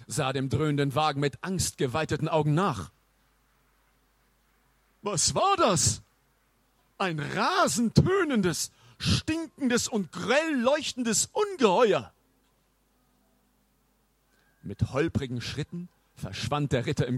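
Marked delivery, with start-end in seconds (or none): 5.0-12.1 high, slow, horrified amazement; shaken knight. Acted. Knight stares after the roaring car, high slow horror. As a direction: exclaim in dread like a bewildered old knight.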